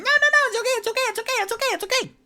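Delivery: very high, loud, rushed it's okay; panicked squeaky calming; high squeaky voice calming screaming halflings; frantic high reassurance. Acted. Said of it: "Tipsy's going".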